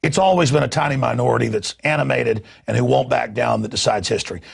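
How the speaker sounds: normal talking voice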